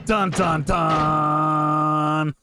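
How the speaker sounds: ordinary talk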